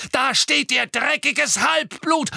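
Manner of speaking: high, loud, drawn-out screech; incoherent shriek. A narrator's reading. Bellatrix raving about Harry, shrieking. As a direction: screech the insult with full outrage. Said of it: "kreischte Bellatrix zusammenhanglos".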